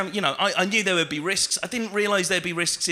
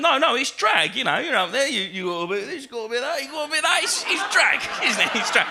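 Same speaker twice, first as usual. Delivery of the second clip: high, louder mock punter insisting; pitching up more with each bit of that; insistent bloke; 7 semitones higher, 3 dB louder, faster than usual. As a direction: ham up the stubborn heckler. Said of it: "and he went".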